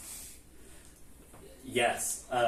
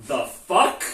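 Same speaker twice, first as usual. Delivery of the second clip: loud, higher-pitched quoted disbelief; incredulous quote; 5 semitones higher, 11 dB louder than usual. Retold or imitated. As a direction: blurt it out as a baffled punchline. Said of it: "[name] delivers".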